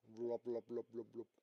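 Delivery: playful wobbling noise, dying away; imitated jelly wobble. A narrator's reading. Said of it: "wabbelt das ganze Auto wie ein Wackelpudding".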